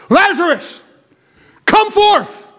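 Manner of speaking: high, ringing call into the tomb; Jesus calling Lazarus out of the grave, loud cry. Acted. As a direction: cry out to raise the dead. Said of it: "he cried with a loud voice".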